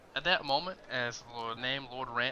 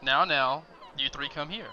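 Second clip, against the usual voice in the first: louder summons; 3 semitones higher, 10 dB louder than usual. Acted. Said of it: "and says".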